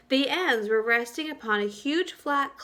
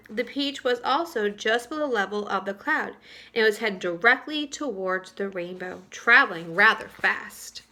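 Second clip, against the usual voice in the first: ordinary delivery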